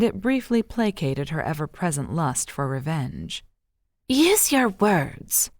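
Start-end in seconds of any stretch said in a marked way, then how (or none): 4.1-5.5 demanding. A narrator's reading. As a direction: demand it, spacing each word. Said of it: "she demanded".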